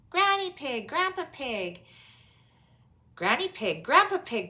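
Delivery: high, loud happy greeting; children greeting grandparents; cried out. A narrator's reading. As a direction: call out joyfully on arrival. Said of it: "cried Peppa".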